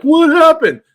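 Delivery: high, loud mock wail; mock crying fan. Mocking Cowboys fans after a loss, high loud whine. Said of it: "their fans cry, like".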